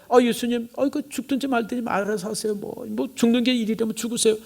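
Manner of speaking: high-pitched dismissive disciple act; mock indifference. Mock uncaring disciple, absurd example.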